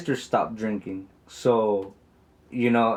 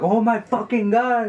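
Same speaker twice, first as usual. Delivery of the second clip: high, drawn-out mock exasperation; pissed-off retelling; 11 semitones higher, 4 dB louder, slower than usual. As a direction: imitate a sister's exasperated wail from the background. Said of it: "getting all pissed and shit".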